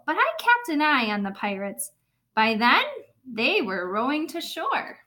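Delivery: put-on lively storybook narration for little kids; animated read-aloud; reading to children. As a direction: read it like story time in class. Teacher reads picture book aloud.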